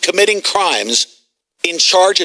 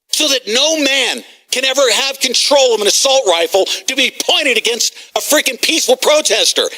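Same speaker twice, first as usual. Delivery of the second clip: ordinary talk, nothing marked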